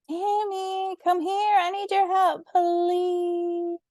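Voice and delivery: put-on high, childlike call through the house; little girl shouting. A narrator's reading. Lovey calling big sister from the kitchen, high childlike shout. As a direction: call sweetly to your sister far off. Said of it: "Lovey shouted".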